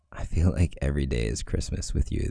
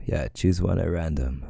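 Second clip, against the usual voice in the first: usual speaking voice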